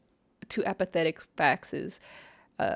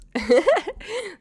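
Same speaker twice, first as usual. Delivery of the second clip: high, louder burst of giggles; laugh; 13 semitones higher, 7 dB louder than usual. Real, not acted. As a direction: giggle at your own slip. Amused by her own slip.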